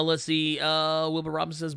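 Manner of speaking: ordinary talk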